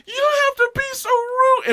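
very high, loud mock scold; high-pitched reprimand, hammed up; indignant scolding woman. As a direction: imitate an offended bystander in a high pitch.

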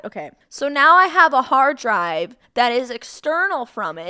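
normal talking voice

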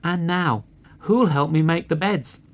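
high-pitched hen question; little red hen. A narrator's reading. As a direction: call for help in a high voice. Hen voiced asking for help.

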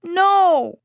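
put-on high, loud child's protest; crying out. Four-year-old protests being poked on the head, high loud cry. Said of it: "he cried".

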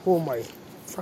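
ordinary talk, nothing marked

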